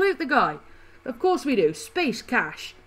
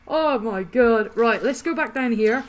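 usual speaking voice

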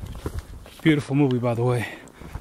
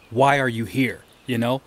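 usual speaking voice

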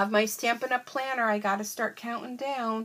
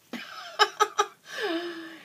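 high, giggly laugh trailing out; giggling laughter; 8 semitones higher than usual. Real, not acted. Laughs at her own vacation wish.

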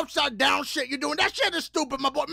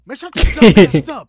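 angry shout; 5 semitones lower, 15 dB louder than usual. Acted. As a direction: bark it out at full volume.

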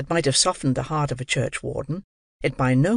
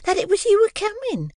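high, humble fawning voice; fawning very high humility; humble Mrs Mann; 13 semitones higher than usual. A narrator's reading. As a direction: grovel sweetly upward. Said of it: "with great humility".

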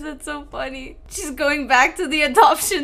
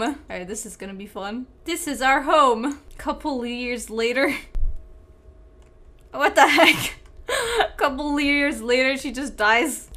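usual speaking voice

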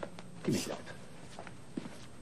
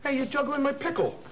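startled outcry; 9 semitones higher than usual. Acted. Catches him juggling pickle.

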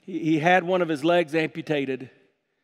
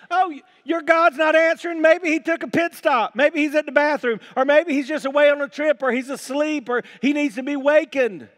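high, raised sarcastic taunt; mocking; 7 semitones higher, 4 dB louder than usual. Elijah mocking prophets whose god won't answer, high sarcastic jeer. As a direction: jeer with mock concern, pitched up. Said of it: "Elijah began mocking them".

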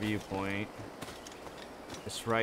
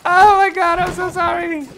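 shrieking high, loud apologies; panicked sorry after bumping someone; squealing oh my God, so sorry; startled apology; 14 dB louder than usual. Real, not acted. Accidentally hits a man, apologizing.

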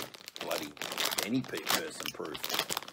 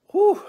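sharp high whew; 18 dB louder than usual. Real, not acted. Exhale after sour candy.

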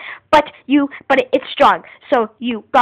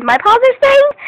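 high, loud excited repeat; excited squeal; 11 semitones higher, 6 dB louder, slower than usual. Real, not acted. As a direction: squeal it in delight. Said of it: "Look, my paws are stained".

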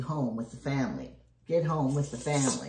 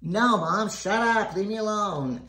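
loud, higher bratty yell at mom; sassy yell; 6 semitones higher, 4 dB louder than usual. Retold or imitated. Kid yelling back at mom in the prank, mock sassy yell. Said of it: "the kid yells back".

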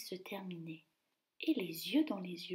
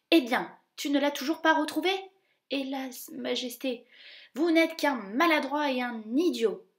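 higher, louder angry royal scolding; furious king; 4 semitones higher, 7 dB louder than usual. Acted. King furious at the grand duke, angry shout. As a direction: berate the clumsy duke.

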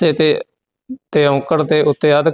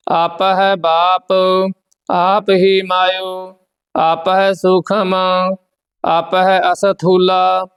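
steady, measured chant of the verses; scripture recitation; 4 semitones higher, slower than usual. Acted. Teacher recites Gurbani verses for the students, steady and measured. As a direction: recite each line evenly, with devotion.

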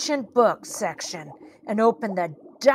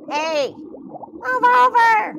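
high-pitched squabbling voices; trapped fish clamouring; 7 semitones higher than usual. Acted. Swallowed fish bump about in Birdie's belly, high-pitched clamour. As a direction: call out high, crammed in together.

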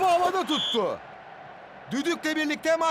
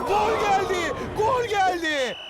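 excited goal call; 4 semitones higher, slower than usual. Acted. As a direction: announce the goal with a slow cry. Goal call in football game.

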